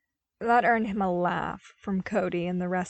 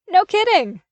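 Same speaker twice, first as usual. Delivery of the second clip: bright, much higher and louder agreement; happy agreement; 11 semitones higher, 11 dB louder than usual. A narrator's reading. Volt happily agreeing with Boomer, high and loud. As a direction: cheer it out gleefully. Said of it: "Volt agreed happily".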